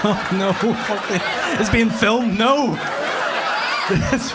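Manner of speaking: high, loud, cracking up; laughing panic at being filmed; flustered laughing. Real, not acted. Comic flustered on camera, laughing high and loud.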